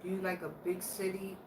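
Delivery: ordinary talk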